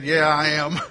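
lightly voiced nervous groom; imitating nervous groom. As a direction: play the groom's answer. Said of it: "He said".

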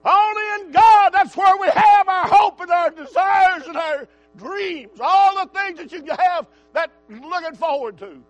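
preaching at full force. Real, not acted. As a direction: preach it at the top of your lungs.

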